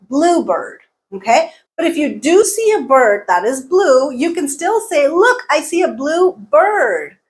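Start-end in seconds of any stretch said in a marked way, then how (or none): 0.1-0.8 "bluebird" as BLUE-bird, high on blue; stress on first part. Shown as a demonstration. Teacher showing a compound word, first-part stress. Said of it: "stress is on the first part". 5.1-7.2 "blue bird" as two words, bird strong and drawn out; higher and slow, full weight on "bird"; phrase stress. Shown as a demonstration. Teacher spotting a bird that is blue, stress on bird. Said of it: "notice how the stress changes".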